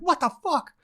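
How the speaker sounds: high-pitched, contained exasperation; retold self-annoyance. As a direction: act out being mad at yourself, pitch jumping up. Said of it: "I'm mad that somehow I missed a mistake".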